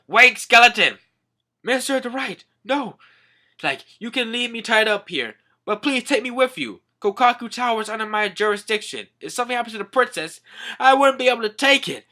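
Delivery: high, loud call, rushing into begging; urgent plea. Retold or imitated. Tied-up minister begs to come along, urgent. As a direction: call after him and plead.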